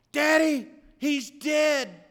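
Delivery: high, loud, frantic scream of grief; retold scream. Daughter screaming news of death into the phone, loud and high. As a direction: shriek it down the phone like a panicked girl. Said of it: "She screamed in the phone".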